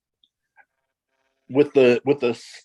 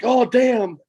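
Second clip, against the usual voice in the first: high startled "Oh, damn"; mock shock; 11 semitones higher, 4 dB louder than usual. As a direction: act out a shocked reaction. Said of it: "You're like".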